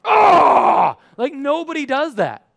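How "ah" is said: loud, high gym roar; mock triumphant yell. Preacher capping his parody of relishing hardship, mock triumphant. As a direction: let out a hammed-up power scream.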